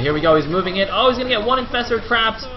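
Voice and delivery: excited esports caster; excited play-by-play. Real, not acted. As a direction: call the attack as it breaks. Live play-by-play of an attack.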